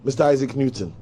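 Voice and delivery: usual speaking voice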